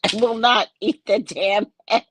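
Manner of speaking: laughing refusal. Real, not acted. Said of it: "refusing with a laugh".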